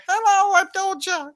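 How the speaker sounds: very high, loud squeaky British falsetto. Acted. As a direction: shriek a cheery British intro in falsetto. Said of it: "everybody's speaking British accent".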